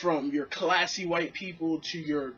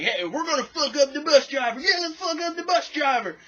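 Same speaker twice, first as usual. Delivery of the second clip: high, loud mock mob rallying; mock the crowd ganging up; imitating the angry bus crowd, high and loud; 10 semitones higher, 5 dB louder than usual. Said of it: "say some shit like".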